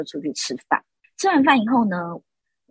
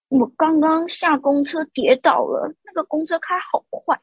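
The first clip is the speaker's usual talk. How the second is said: higher, slow, self-pitying whine imitating the mother; whiny complaint; 9 semitones higher, slower than usual. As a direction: complain like a sulking little princess. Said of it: "他就说". (模仿婆婆拉高音慢慢撒娇抱怨)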